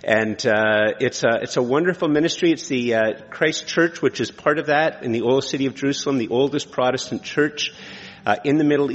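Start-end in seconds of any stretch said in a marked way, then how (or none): none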